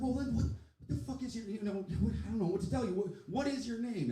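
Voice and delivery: high, stammering exasperated demand; flustered exasperation. Retold or imitated. Comic stumped by an unruly guest, exasperated.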